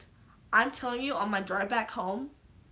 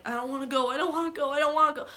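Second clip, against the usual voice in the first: higher, rising mock panic; acted desperate pleading; 6 semitones higher, 3 dB louder, much faster than usual. Acting out Spider-Man dying in Tony's arms, pleading. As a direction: beg not to go, pitch climbing. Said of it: "I'm gonna act out the scene here".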